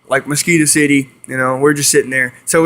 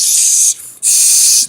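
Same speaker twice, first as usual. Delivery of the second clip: sustained imitated gator turtle hiss. Turtle held by the friend, still hissing. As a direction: hiss like a turtle warning off its captor. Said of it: "the gator's still hissing at him".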